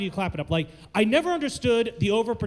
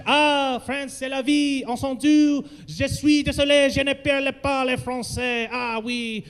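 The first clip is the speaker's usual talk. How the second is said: hammed-up French with a lilt; theatrical mock French accent; 4 semitones higher, slower than usual. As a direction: play a caricature Frenchman, voice raised. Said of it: "do French".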